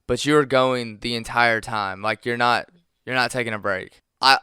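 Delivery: normal talking voice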